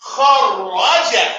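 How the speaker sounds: kharaja stretched, doubled r held; slow model word. Shown as a demonstration. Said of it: "Everybody say it with me".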